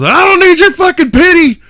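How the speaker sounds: high, loud mock outrage; mock angry. The comedian yells at the pitying crowd in high, loud mock anger. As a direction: snap at the crowd for fun.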